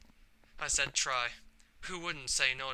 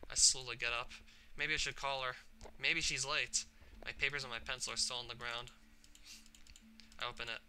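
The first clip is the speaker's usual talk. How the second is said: plain reading voice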